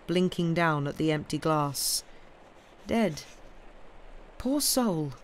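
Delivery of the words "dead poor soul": a quiet, halting lament; murmured pity. A narrator's reading. Mister Jarvie on the dead woman, murmured lament. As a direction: murmur it regretfully. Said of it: "murmured Mister Jarvie".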